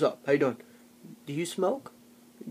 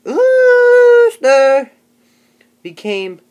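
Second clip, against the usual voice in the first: slow, halting, higher and louder read-aloud with squeaky peaks; mocking Tony's reading; 6 semitones higher, 8 dB louder, much slower than usual. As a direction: ham up a clueless kid sounding out a story. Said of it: "this was Tony".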